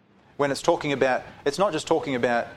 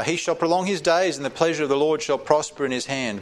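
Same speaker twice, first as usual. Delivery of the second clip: raised, ringing Bible reading; solemn raised reading voice; scripture reading; 3 semitones higher than usual. Acted. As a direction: proclaim the verse to the congregation. Reads scripture aloud.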